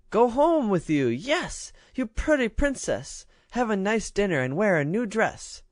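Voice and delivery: high, eager baby-voiced child; delighted little girl. A narrator's reading. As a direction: jump at the offer. Ragged girl accepts gladly.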